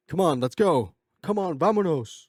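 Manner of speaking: loud, high rallying call; urging. Retold or imitated. Son urging his mom to leave, loud playful rallying. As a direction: hurry someone along with mock enthusiasm.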